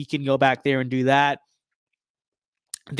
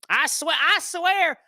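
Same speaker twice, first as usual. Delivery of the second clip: high, slow Southern drawl, hammed up; exaggerated Southern accent, high and slow; mock Southern Florida accent; 12 semitones higher, much slower than usual. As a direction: play a Florida good old boy griping about Democrats. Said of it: "Florida's people's accents are not that thick".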